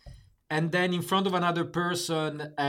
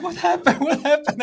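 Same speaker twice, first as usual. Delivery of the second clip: much higher and louder; alarmed question; 12 semitones higher, 9 dB louder than usual. Retold or imitated. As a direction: burst in asking what happened. Retelling finding friend crying.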